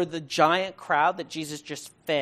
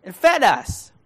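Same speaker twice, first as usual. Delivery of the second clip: mock cheeky crowd; 7 semitones higher, 9 dB louder than usual. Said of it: "he's saying".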